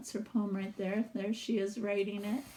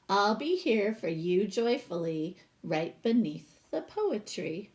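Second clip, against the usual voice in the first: lively read-aloud storytelling voice; storybook reading; 5 dB louder than usual. Acted. Teacher reading a picture book to her class, expressive read-aloud.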